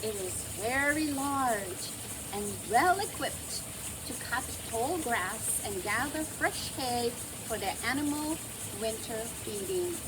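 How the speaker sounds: usual speaking voice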